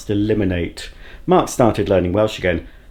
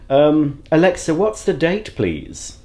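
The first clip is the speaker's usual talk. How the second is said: ordinary delivery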